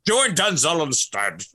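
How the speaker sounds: slow, lightly put-on actor voice; drawn-out mock film-star delivery; echoed star impression. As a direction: echo the line in a mock burr.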